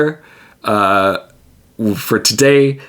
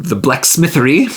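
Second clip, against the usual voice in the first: ordinary delivery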